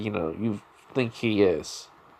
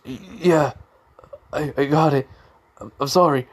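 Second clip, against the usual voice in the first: stammering, higher-pitched frightened apology; trembling fear; 4 semitones higher than usual. Acted. Rival feeling fear, saying he got it, stammering. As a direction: stumble on first words, giving in fearfully. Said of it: "trembling a little bit".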